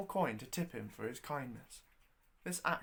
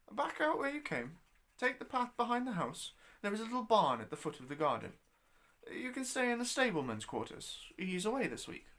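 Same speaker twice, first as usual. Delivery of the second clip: put-on much higher, louder old Scottish landlady; 7 semitones higher, 3 dB louder than usual. Landlady sending guest out to the barn, high crafty voice. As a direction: cackle out the directions like a crafty old wife.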